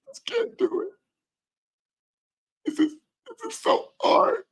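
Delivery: high, tiny, squeaky mock weeping; fake crying. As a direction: put on a pitiful, barely-voiced sob act. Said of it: "when she goes into her crying".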